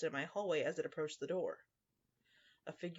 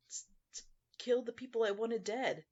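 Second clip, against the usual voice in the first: high, louder, stammered-in blurt; as if obvious; 6 semitones higher, 5 dB louder than usual. A narrator's reading. Girl explaining her gifts to the narrator, matter-of-course blurt. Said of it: "as if the question had an obvious answer".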